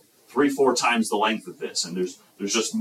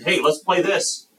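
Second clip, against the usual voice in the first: higher, drawn-out playful call; kids' mock shout; 7 semitones higher, slower than usual. Child inviting others to play, mildly shouted. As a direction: call slowly to playmates, without a real shout. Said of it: "these kids are shouting".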